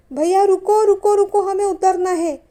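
high, loud panicked plea to the operator; frightened cry to stop. A narrator's reading. Wife on the Ferris wheel begging the operator, panicked high shout. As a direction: yell to be let off the ride in fear. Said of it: "असा बोलण्याचा निष्फळ प्रयत्न".

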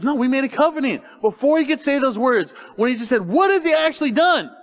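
high, loud, insistent retelling; retold protest. Preacher retells Jonathan standing up to Saul, loud and insistent.

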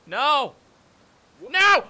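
loud, rising shouts of dismay; anguished shouts. Real, not acted. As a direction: shout in real disbelief. Screaming at a fall.

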